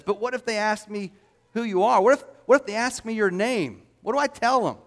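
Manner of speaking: a touch of anxious protest; lightly voiced Moses. Moses anxiously questions God in retold dialogue.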